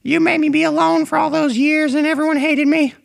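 teasingly parodied hero pouring out his feelings; Naruto, emotional. Retold or imitated. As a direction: squeak it out like an overwrought cartoon boy. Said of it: "and he was like".

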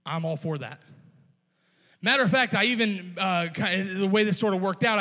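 usual speaking voice

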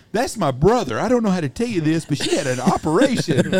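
hammed-up caller breaking bad news; mock prank caller. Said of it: "He says".

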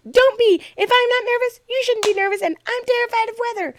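high, insistent voice; emphatic retold reassurance. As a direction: insist at the top of your range. Retells emphatic reassurance to friend.

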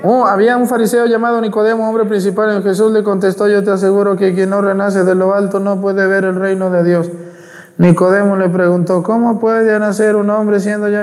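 high, rushed, sing-song gospel read; droning rushed reading. Shown as a demonstration. Priest mocking a careless lector: high, hurried drone. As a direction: rattle it off like a bored reader. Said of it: "con eso me duermo, ya me empiezan a arrullar".

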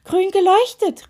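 high, loud excited shout; suddenly wide awake. A narrator's reading. Witch suddenly realising what glows, shouting wide awake. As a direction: burst out, startled fully awake. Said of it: "rief sie plötzlich hellwach".

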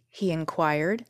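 ordinary delivery

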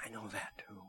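imitated whisper, breath only; whispered reply. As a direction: whisper back in agreement. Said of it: "she says".

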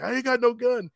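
high, loud nervous denial; imitating a frightened man; scared denial. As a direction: back down fast. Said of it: "he's like".

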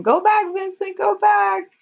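high mock plea to a dog; imitated boy calling. Boy tells his swimming dog to go back, high imitated call.

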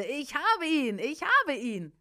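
high, loud repeated cry; triumphant call. A narrator's reading. Preacher calls back at the demon, high and loud. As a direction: call it out, pitched well up. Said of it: "rief ich es noch einmal".

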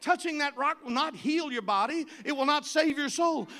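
fiery preacher driving the point home; full-force preaching. Acted. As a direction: preach it hard to the congregation.

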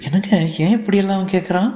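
high-pitched shocked wife. Acted. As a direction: ask it high, taken aback. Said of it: "Banu அதிர்ச்சியுற்று". (அதிர்ச்சியுடன் உயர்ந்த குரலில்)

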